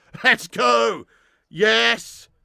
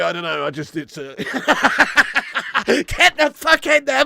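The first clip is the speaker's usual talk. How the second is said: normal talking voice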